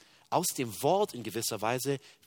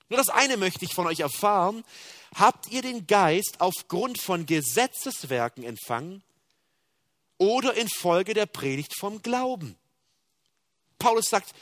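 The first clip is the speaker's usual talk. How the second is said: usual speaking voice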